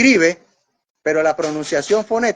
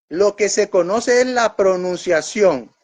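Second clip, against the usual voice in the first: normal talking voice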